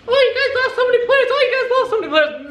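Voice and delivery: very high, laughing mock voice; mocking taunt. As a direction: mimic the haters with a laugh. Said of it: "mocking doubters' taunt".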